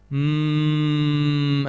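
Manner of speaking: long, high closed-mouth groan held steady; imitated groan through sealed lips; stifled pained hum. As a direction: hold back pain in one drawn-out "mmm". Imitates silent endurance of pain.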